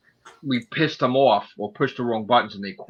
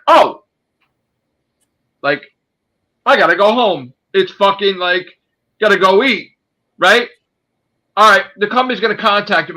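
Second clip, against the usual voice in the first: higher, louder mocking voice; mocking manager; 6 semitones higher, 5 dB louder than usual. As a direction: sneer at the lazy manager. Mocking manager leaving early.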